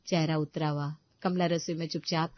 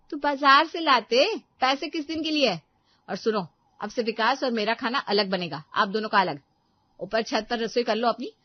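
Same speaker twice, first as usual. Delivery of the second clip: loud, higher, contemptuous tirade; scolding daughter-in-law; 6 semitones higher, 8 dB louder than usual. Acted. Daughter-in-law to mother-in-law over dinner, loud and scornful. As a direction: throw the old couple upstairs with scorn.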